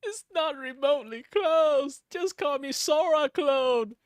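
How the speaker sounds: high, slow, loud mocking of a commenter; a hint of a jeering commenter; mock insult voice. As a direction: sneer it like a troll in chat.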